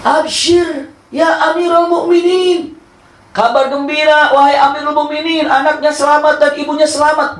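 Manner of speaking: high, loud joyful call; high excited shout of good tidings; shouting good news. Retold or imitated. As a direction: call out the glad news across the house. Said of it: "teriak mengatakan".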